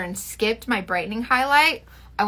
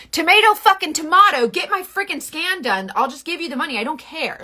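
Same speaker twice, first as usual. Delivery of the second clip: quoting herself snapping at the clinic staff; exasperated outburst; 6 semitones higher than usual. Retold or imitated. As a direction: blurt it out in total exasperation. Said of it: "And I'm like".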